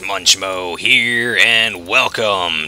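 loud, drawn-out show intro; hearty host opening, words held long; announcer-style greeting. Acted. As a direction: stretch the welcome out big and slow.

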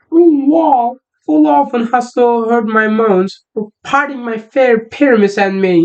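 put-on a boy camping up a high girly Thisbe; girl voice. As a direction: squeak out the lines like a lovesick girl. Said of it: "Şimdi o kız sesini yapmaya çalışacağım".

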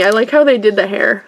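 normal talking voice

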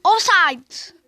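yüksek sesle offside; ofsayt diye bağırış. Real, not acted. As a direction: zaferle ilan et. (ofsayt yakalayınca yüksek sesle bağırıyor)

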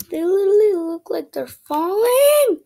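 high and loud, amazed; alarmed exclaim. Real, not acted. Gamer shouting at falling platforms on stream, alarmed and loud. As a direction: blurt it out, alarmed.

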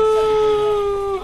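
loud high-pitched woo cheer. Acted. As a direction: let out a big high woo. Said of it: "I'm trying to be more energetic".

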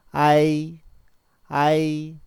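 아이 loud and held, the vowels gliding together on a level pitch; repeat-after-me model. Shown as a demonstration. Teacher models 아이 for students to repeat, loud and sustained. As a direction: chant 아이 slowly for learners to copy. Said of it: "자, 한번 따라해볼까?".

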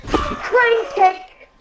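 high battle call; called move name. Acted. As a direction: announce the kick like a fighter. Calling out fighting moves.

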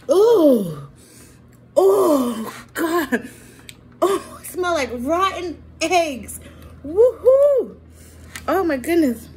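loud, high disgusted cries; disgusted by smell. Real, not acted. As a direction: react loudly to a rotten stink. Smells the opened fruit.

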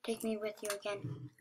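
low, soft plea; quiet request. Pretend. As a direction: ask it low and under your breath.

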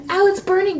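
usual speaking voice

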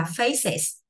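"faces" with the ending as a separate is syllable; two-syllable plural. Shown as a demonstration. Teacher modelling plural of face, "faces" in two syllables. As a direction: sound out both syllables of "faces". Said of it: "bắt phải đọc là is".

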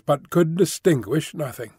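ordinary delivery

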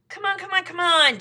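loud, high, repeated urging; impatient shout. Acted. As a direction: hurry her up, yelling. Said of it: "shouted the centipede".

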